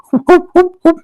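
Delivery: high yapping whoops for a bark; a hammed-up fake dog bark; mock dog barking. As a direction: bark like a small dog on attack. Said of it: "Let us do more woof".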